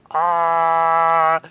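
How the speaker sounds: long, loud held "ah" yell; loud, high, steady yell; acted yell. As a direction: belt out a sustained open-mouthed shout. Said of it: "Yell, yell".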